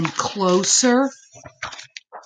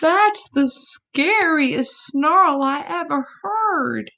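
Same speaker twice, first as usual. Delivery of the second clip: high, frightened possum voice; high scared little voice; frightened gasp; 10 semitones higher, 4 dB louder than usual. A narrator's reading. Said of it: "Epossumandus gasped".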